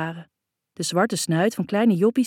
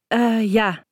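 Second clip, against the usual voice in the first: stammering; 4 semitones higher, 4 dB louder than usual. A narrator's reading. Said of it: "stotter ik".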